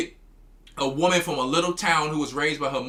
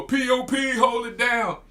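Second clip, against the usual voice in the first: mock street talk; 5 semitones higher than usual. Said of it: "when you hear them talking like a man".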